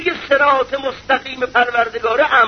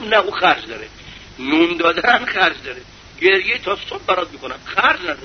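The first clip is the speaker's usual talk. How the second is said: stingy rich man; 8 semitones lower than usual. Retold or imitated.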